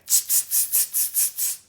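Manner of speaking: rapid string of voiceless tsks; voiceless mocking monkey chatter; monkey "tss" noises. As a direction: hiss short tss sounds like cheeky monkeys. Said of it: "shook their hands and said".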